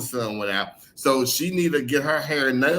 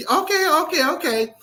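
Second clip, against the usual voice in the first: rapid, much higher mock-eager okays; 4 dB louder than usual. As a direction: play her overeager agreement, pitched way up. Girlfriend eager to agree.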